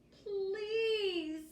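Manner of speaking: high, loud pleading little piglets; begging piglets. Acted. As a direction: plead like a sleepy child. Piglets beg for a story.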